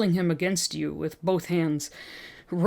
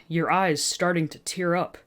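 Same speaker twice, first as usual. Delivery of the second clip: ordinary delivery, nothing marked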